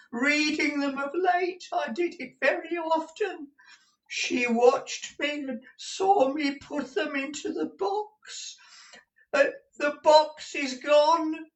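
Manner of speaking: put-on very high, slow old miser's lament; ruined old man shrieking his grief; distraught wailing. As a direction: wail each phrase in despair, stretched out. Gride raves over stolen papers.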